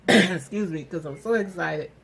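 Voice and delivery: usual speaking voice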